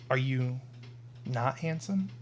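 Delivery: femme fatale. Acted. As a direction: coo it teasingly. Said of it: "play the role of Olivia".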